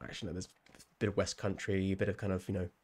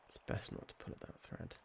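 whispered under the breath; barely voiced cautionary whisper; hushed aside; 3 semitones lower, 14 dB quieter than usual. Real, not acted. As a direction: repeat it as a very soft, low warning to yourself.

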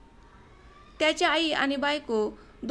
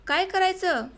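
काय करायचं thrown out much higher, the pitch falling at the end; anguished outcry; 6 semitones higher than usual. A narrator's reading. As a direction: cry out काय करायचं in despair. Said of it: "कपाळाला हाताची मूठ मारून घेतली".

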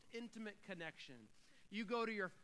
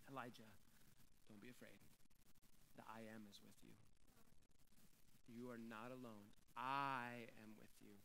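very soft and low, tender reassurance; gentle God voice; 10 semitones lower, 11 dB quieter than usual. Acted. God softly comforting the exhausted Elijah. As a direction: hush it down, comfort the broken prophet.

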